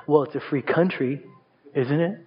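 low, lazy comeback; sassy sibling retort. Retold or imitated. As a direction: toss it back with a shrug. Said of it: "And they would say".

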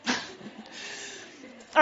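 breathy laugh through the words. Real, not acted. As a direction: laugh it off and move on. Laugh after joke.

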